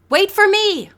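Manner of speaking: high and loud, call from across the room. A narrator's reading. Latecomer calls to the group, loud. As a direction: shout so they wait for you. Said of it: "a man's voice rang out".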